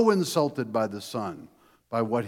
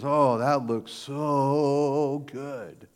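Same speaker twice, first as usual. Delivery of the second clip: craving son; 2 semitones higher, much slower than usual. Acted. Hungry son eyeing pig food.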